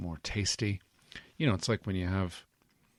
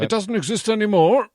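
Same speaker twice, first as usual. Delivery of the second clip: Santa voice; 13 semitones higher, 9 dB louder than usual. Acted. Santa voice, high and loud.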